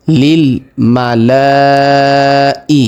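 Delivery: মালাই with the first vowel held for four alif counts, chanted; long vowel stretch. Shown as a demonstration. Teacher demonstrating the four alif lengthening in মালাই. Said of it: "চার আলিফ পরিমাণ টান হবে".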